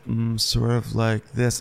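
slow, halting mouth-breather drawl; mumbling son impression. Demonstrating how the son speaks, slow and dopey. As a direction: drag it out like an inarticulate grown son. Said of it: "he speaks".

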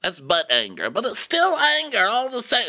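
high put-on diva voice; hammed-up high voice, lecturing self-importantly; Erica. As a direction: hold a high, exaggerated housewife impression. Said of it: "So Erica's like".

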